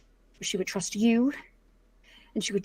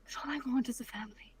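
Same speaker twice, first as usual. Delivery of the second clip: soft, breathless whispered plea; 10 dB quieter than usual. Acted. Daughter whispering her wish to her father. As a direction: let the wish slip out as a whisper.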